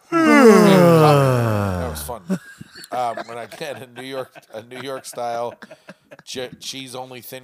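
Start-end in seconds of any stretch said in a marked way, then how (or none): 0.1-2.2 very slow, loud, high mock crying; mock wail. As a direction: wail it in comic heartbreak. Wailing over pizza.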